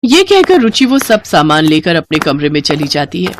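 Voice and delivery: ordinary delivery, nothing marked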